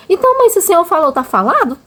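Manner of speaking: a light hint of a girl nudging her mother; daughter's voice.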